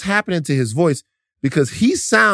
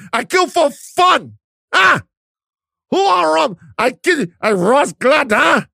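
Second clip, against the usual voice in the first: put-on Jamaican movie-gangster accent; 9 semitones higher, slower than usual. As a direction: ham up a Jamaican gangster from Belly. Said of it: "do the accent".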